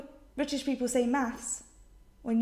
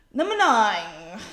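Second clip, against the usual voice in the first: announcer call; 8 dB louder, slower than usual. Real, not acted. Announces the list item.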